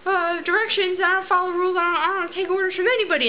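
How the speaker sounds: whiny mock protest of a rebellious dance-movie rebel; defiant street dancer. Street dancer refusing orders in ballet class, mock defiant whine. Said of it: "when the street dancer is just like".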